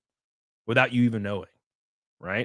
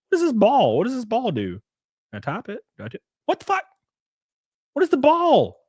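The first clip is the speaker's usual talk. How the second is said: confused outburst; 10 semitones higher than usual. Real, not acted. Confused by the new video player.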